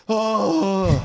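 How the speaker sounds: high, loud disappointed oh; a child's let-down groan; kids' disappointed oh. Retold or imitated. Dad imitating his kids hearing he must leave. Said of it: "they went".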